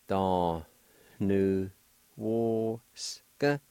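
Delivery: "Da, nu, wo" each syllable set apart; sounded out syllable by syllable. Shown as a demonstration. Teacher breaking a word into sounds for the class. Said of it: "will make a nasalized sound like this".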